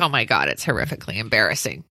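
low, fast mock teen gripe; mother imitating her son's complaint; mortified teen. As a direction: rattle it off as a bored teenager. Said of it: "and it's like".

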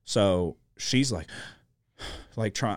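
ordinary talk, nothing marked

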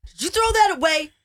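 stern questioning of a husband. Shown as a demonstration. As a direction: demand an answer like a cross wife. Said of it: "that is my stern [name] voice".

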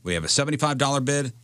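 ordinary talk, nothing marked

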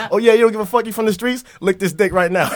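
goading, with the voice pushed up; retold taunting dare. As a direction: imitate someone calling a bluff. Acted dare from the dude.

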